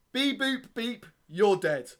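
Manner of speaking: high robotic beeps, then a loud verdict; hammed-up absurd poem ending; slam poetry. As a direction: punch out the finish theatrically. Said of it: "that was slam poetry".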